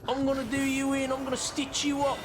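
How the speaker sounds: hammed-up British tough-talk; mock menace in overdone British; overdone British accent. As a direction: threaten in an exaggerated British accent. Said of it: "the British one, but overdone".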